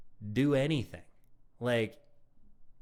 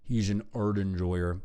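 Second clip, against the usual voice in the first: mocking repeat; slower than usual. Mockingly repeats the clip's words.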